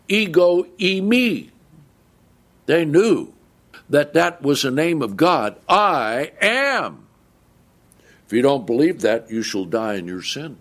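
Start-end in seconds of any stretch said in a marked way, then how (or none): 0.1-1.5 "ego eimi" said higher and louder, both words weighted; emphatic. Shown as a demonstration. Preacher explaining the Greek, stressing "ego eimi". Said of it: "that's the emphatic". 5.7-7.1 "I am" lifted high and weighted; emphatic. Shown as a demonstration. Preacher proclaiming Jesus' "I am" to the congregation, emphatic. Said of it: "that's the emphatic".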